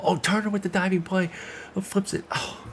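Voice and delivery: sudden high-pitched excitement; excited. Real, not acted. Gamer amazed by a diving play, excited. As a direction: yelp it out, thrilled by the play. Amazing defensive play on stream.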